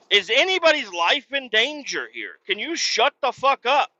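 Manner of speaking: mock the pointless rule; retold angry retort. Dad challenging the lifeguards, sarcastic anger. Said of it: "I'm like".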